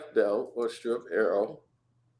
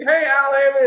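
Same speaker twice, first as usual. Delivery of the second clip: calling out; 13 semitones higher, 8 dB louder than usual. Retold or imitated. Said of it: "they'd be".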